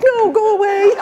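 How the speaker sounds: very high mock frightened plea; hammed-up frightened student; scared student. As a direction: squeal like a scared freshman. Students' scared reaction, voiced.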